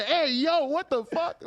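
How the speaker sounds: high-pitched mock outburst through laughter; meme imitation. Co-host quotes a meme to friends, high laughing mock shock. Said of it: "Y'all know the meme".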